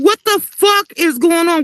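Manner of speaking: exasperated demand. Retold or imitated. Mother demands answers from son.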